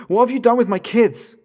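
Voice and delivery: high, loud desperate yell; frantic shout. Acted. Father yells down the toy phone at the kidnapper. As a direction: scream it at the kidnapper.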